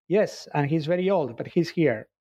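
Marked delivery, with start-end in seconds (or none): none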